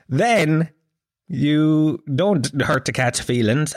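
ordinary talk

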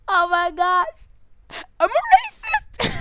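very high, loud mock-horrified squeal; mock horror. Host pretending shock at himself, high mock horror. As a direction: squeal it way up high, aghast for laughs.

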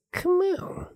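high, soft mock coaxing; Colton's catchphrase. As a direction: imitate a friend's soft, insistent pleading. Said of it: "the Colton phrase that we".

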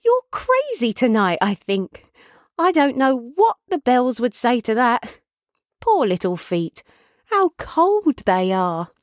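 Meg, fondly fussing. Acted. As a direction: fuss over father and child in a high voice.

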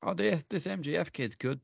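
mocking fan voice. Mocking fans of the other show.

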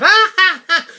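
high loud fake ha ha ha; mock laugh. Retelling the tax lady's laugh, mock high and loud. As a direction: imitate her laugh, bright and loud. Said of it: "And she laughed".

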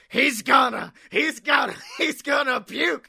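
high, loud mock shout; movie scene yell. Onlooker in a wrestling movie yelling about Droz, mock shout.

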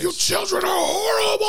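high wailing cartoon shout; crying shout. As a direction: wail it like a sobbing villain. Acted cartoon character outburst.

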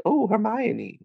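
high, dreamy swoon over the name; swooning kids. Retold or imitated. Boys at camp swooning over Hermione, high dreamy swoon. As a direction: swoon like smitten little boys. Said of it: "the children were like".

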